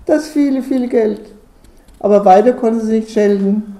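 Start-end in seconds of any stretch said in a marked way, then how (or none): 0.1-1.2 higher-pitched moaning complaint; wailing lament. A narrator's reading. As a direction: bewail it with theatrical dismay. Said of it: "zeterte da die Tuschnickelfrau".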